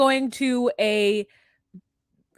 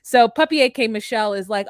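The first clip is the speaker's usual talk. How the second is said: usual speaking voice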